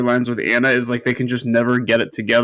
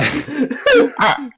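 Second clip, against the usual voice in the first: put-on very old man; 13 semitones higher than usual.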